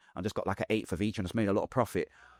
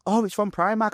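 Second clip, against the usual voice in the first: high, loud mock surprise; surprised girl; 11 semitones higher, 4 dB louder than usual. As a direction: act out her discovery of the label. Voicing girl seeing shirt label.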